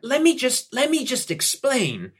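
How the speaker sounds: don't let her leave; pleading salesman. Acted. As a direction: salesman begging to pitch.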